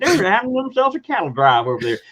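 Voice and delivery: cowboy marveling at the cattle in Texas; Texas accent. Retold or imitated. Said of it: "this alien prince has a Texas accent".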